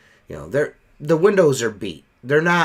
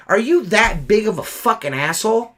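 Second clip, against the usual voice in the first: higher-pitched, indignant challenge; retold losing it; 6 semitones higher, 3 dB louder than usual. Window cleaner cussing at rude customer, retold losing it. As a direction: relive cussing someone out, pitch up. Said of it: "I just lost it".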